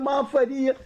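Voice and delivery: high, coaxing mock complaint; imitating a fan's disappointed plea; pleading reproach. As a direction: plead high, half-scolding a friend. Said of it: "he said".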